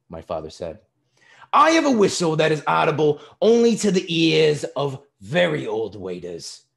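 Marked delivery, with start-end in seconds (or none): 1.5-6.7 high, loud, pompous taunt; loud mockery. A narrator's reading. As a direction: taunt the waiter for all to hear. Father mocking the old waiter.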